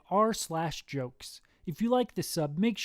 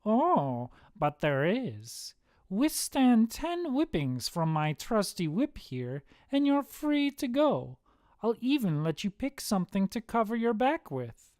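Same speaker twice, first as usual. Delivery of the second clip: Satan; 6 semitones higher than usual. A narrator's reading. Satan bargains with damned men, theatrical and higher. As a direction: offer the deal like a showman devil. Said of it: "Satan suddenly appears and says".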